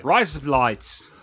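slow Australian twang, words run together; drawn-out Aussie "razor blades". Shown as a demonstration. Repeating the trick after co-host explains it, slow Australian accent. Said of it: "You just said razor blades in Australia".

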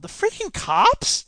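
very high, incredulous question; scowling disbelief. A narrator's reading. Dave learns the cops were in on it, incredulous. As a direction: let the voice leap up in outrage. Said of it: "He scowled".

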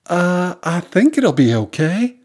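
high, slow, unsure answer; uneasy character voice, slow and high; hesitant reassurance. A narrator's reading. Guest answers about her phone.

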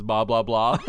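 Bob Blah Blah run together quickly; said really fast. Shown as a demonstration. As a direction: blur Bob Blah Blah into babble. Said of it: "if you say it really fast".

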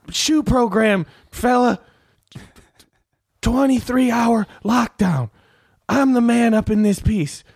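high, heated tough-cop rant building; Denzel rant impression. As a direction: swagger and threaten. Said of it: "Denzel Washington's character".

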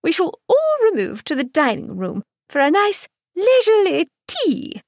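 high, bird-like chirping society matron; chirpy hostess. A narrator's reading. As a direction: chirp it brightly, a fussy hostess. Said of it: "Missus York chirped".

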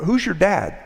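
high-pitched, casual asking; light, nosy small-town query; curious question. Retold or imitated. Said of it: "sometimes out of curiosity".

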